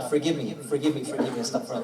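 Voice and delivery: low, hushed self-correction; apologetic aside. Real, not acted. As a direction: murmur a real plea for forgiveness. Corrects himself, asks forgiveness.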